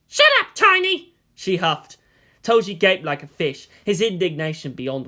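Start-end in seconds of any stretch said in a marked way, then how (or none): 0.1-1.1 put-on snapping girl. Asuka snapping at Toji.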